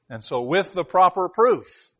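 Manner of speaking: ordinary delivery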